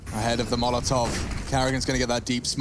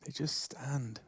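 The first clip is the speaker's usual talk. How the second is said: hushed caster whisper; 11 dB quieter, slower than usual. Real, not acted. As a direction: drop to a whisper for tension. Caster hushes during tense round.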